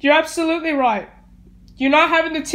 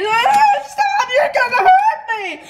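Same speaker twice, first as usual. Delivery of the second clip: high, loud panicked sobbing; crying shout; 9 semitones higher, 3 dB louder than usual. Real, not acted. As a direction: scream through tears at the door. Child fights at the door.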